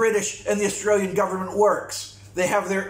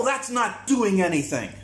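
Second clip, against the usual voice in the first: usual speaking voice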